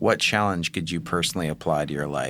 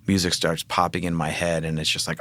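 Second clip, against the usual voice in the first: ordinary delivery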